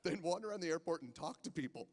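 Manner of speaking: ordinary talk, nothing marked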